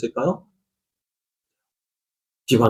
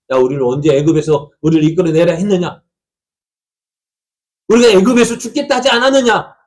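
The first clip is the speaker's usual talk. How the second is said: rising, much higher and louder complaint; angry grumbling; 4 semitones higher, 5 dB louder than usual. Acted. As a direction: shout the grievance at Moses, climbing. People grumble against Moses. (모세에게 원망하며 높고 크게 외치듯)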